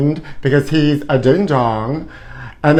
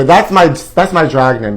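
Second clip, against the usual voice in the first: normal talking voice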